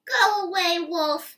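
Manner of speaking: shouting. A narrator's reading. Pig yells back from inside.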